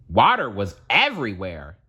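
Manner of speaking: ordinary delivery, nothing marked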